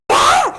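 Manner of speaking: sudden high yelp of fright; startled scream. Acted. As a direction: shriek as if grabbed from behind. (صرخة فزع عالية مفاجئة)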